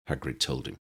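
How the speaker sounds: ordinary delivery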